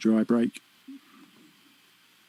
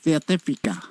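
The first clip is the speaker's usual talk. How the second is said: a quick South African twang, pitched up; mock South African accent; 6 semitones higher than usual. Commentator showing off a South African accent to co-host. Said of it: "That's my South African accent".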